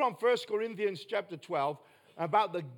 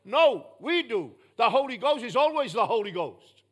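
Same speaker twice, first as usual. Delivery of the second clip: raised preacher voice, punching key words; forceful, real pulpit volume; loud emphatic preaching; 3 semitones higher, 6 dB louder than usual. Real, not acted. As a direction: hammer the point home loudly. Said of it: "Am I too loud? No. I'm just trying to emphasize a point".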